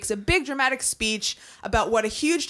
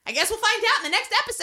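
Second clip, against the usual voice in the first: high, fast playful tease; excited teaser; 12 semitones higher, much faster than usual. Acted. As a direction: tease the next episode brightly.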